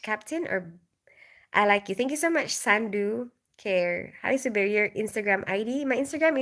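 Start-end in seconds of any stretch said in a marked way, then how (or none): none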